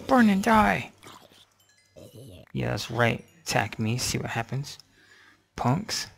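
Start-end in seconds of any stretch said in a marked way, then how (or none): 0.1-0.9 louder, higher taunting yell; shout. Real, not acted. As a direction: really yell it at them. Yelling at burning mobs.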